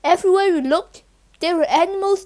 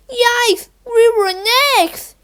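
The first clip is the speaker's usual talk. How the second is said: gasped fright; 7 semitones higher than usual. Acted. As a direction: blurt it out in sudden alarm. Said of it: "he gasped".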